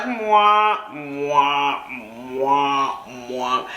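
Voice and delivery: low, droning "mwah" noises; wordless wah-wah mimicry of speech; droning talk imitation. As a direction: imitate someone's talk turning to meaningless drone. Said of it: "I hear him talking, but it's like".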